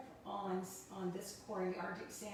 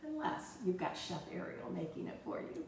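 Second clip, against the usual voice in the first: ordinary talk, nothing marked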